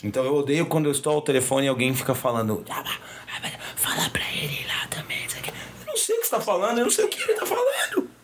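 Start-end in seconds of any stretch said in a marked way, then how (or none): 2.7-5.5 soft unvoiced whisper. Imitates someone whispering nearby. 5.8-8.1 voice cracking. Real, not acted. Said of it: "Minha voz falhou de verdade agora".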